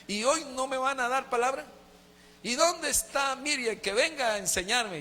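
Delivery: put-on old man turned childlike, begging for his lesson; childlike father. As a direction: ask eagerly like an impatient little kid. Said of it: "Como un niño decía".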